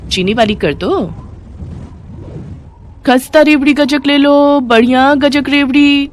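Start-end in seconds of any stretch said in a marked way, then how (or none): 3.1-6.1 higher, sing-song vendor cry; hawker's call. Acted. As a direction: call out to passing buyers. Street vendor calling out wares.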